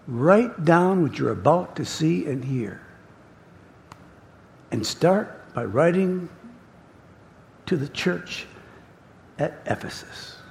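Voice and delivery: soft, measured instruction; quiet divine charge, fading at the end; Jesus. Acted. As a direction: dictate gently with long pauses. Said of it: "that voice is talking to John".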